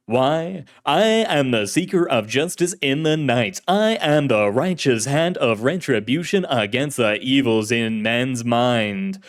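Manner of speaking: put-on grandiose old-time hero voice; radio serial hero. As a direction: declaim it like a pulp radio hero. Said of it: "His voice was like an actor in an old-fashioned serial".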